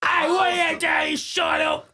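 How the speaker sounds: unhinged villain screaming, high and slow; crazy voice. Acted. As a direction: shriek it like a madman. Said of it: "I'm gonna do a crazy voice".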